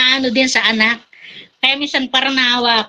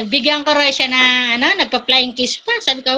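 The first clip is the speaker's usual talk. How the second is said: ordinary talk, nothing marked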